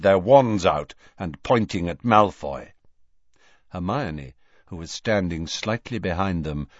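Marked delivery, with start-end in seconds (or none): none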